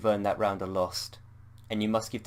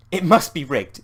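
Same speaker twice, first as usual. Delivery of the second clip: higher, louder accusing cry; exclaiming; 7 semitones higher, 10 dB louder than usual. Acted. Anne accusing the dice of being rigged, loud. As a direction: blurt it out, sure it's a cheat. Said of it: "she exclaims".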